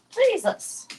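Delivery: high, drawn-out Jesus rising far up in disbelief; a real amazed squeal at her dog. Real, not acted. Dog doing the splits.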